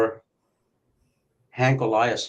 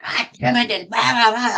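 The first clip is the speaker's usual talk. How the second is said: retold yelling; 11 semitones higher, 4 dB louder than usual. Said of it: "he starts fucking yelling".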